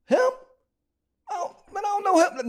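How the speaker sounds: retold stunned denial, high and incredulous; stunned disbelief. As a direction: sell the disbelief, pitch jumping way up. Retold shock at seeing the victim.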